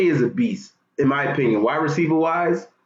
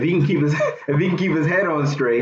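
laughing. Real, not acted. Laughs mid-sentence.

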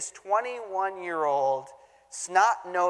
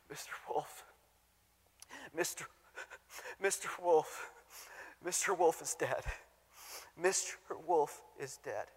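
put-on hushed, whispered, softer grieving child; 10 dB quieter than usual. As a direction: let it come out in a soft tearful hush. Crying boy tells of dead dog.

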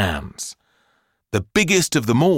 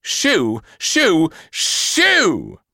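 shooing; 8 semitones higher, much slower than usual. Acted. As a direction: shoo rising higher each time. Shooing the girl out.

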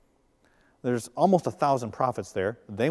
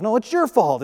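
put-on childish comeback; 7 semitones higher, 4 dB louder than usual. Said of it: "like a middle school argument".

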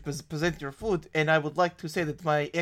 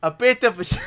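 normal talking voice

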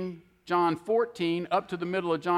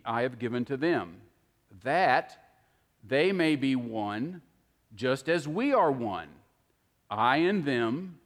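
slow, unhurried reading with long pauses, a little lower; 4 semitones lower than usual. Shown as a demonstration. Preacher reading Jesus' prayer to listeners, slow and deliberate. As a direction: linger on every phrase of the prayer. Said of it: "So I'm gonna read a few of them slow".

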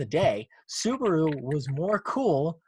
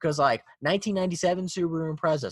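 ordinary delivery

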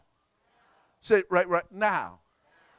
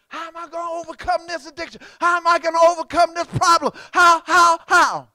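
high, loud, hammed-up cry; imitated desperate plea; 12 semitones higher, 12 dB louder than usual. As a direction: cry it out as a struggling churchgoer. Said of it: "Some of you asking that question".

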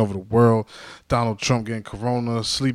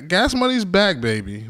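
hype catchphrase; 7 semitones higher, 4 dB louder, slower than usual. Real, not acted. Host hyping the show's comeback, excited catchphrase. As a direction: hype the return like a show host. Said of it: "Why did I say back, baby".

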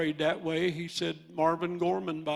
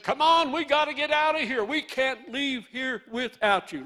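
high, loud urgent call, much higher pitched; angels urging; 8 semitones higher, 5 dB louder than usual. Retold or imitated. Angels urging Lot's family to flee Sodom, loud and high. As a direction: hurry them out, calling loud. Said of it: "They said".

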